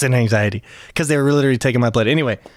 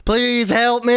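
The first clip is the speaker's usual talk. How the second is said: whimpering plea; 10 semitones higher, slower than usual. Retold or imitated. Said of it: "you know what I said".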